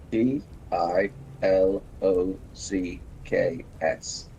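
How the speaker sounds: letter by letter, slowly; spelling it out slowly. Shown as a demonstration. Spelling the word out slowly for a stream guest. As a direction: spell each letter out deliberately. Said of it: "With an S at the end".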